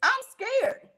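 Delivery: I'm scared jumped high; mock fear, high-pitched. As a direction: play scared with a high squeak. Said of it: "I'm like".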